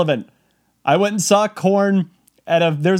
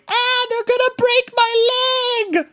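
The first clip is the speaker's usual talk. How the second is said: high, drawn-out mock wail; screaming and crying; slower than usual. Trapped girl in the crowd, mock crying scream. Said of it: "she is screaming and crying".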